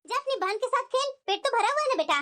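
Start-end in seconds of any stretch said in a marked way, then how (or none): none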